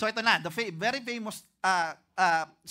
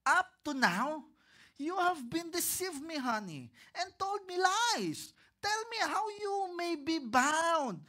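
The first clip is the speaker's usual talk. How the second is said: much higher, slow, sulky pouting complaint; whiny Delilah; 8 semitones higher, slower than usual. Acted. Delilah complains a third time, high and whiny. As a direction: whine like a spoiled sweetheart, stretching it out. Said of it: "Pabebe si Delilah".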